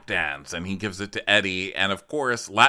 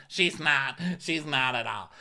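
normal talking voice

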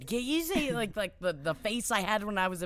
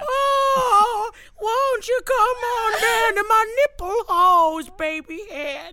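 very high, loud crooning voice; hammed-up character crooning; talking breast; 7 dB louder, slower than usual. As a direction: beckon in an absurd high wail. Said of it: "the talking breast".

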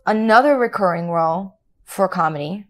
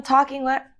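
normal talking voice

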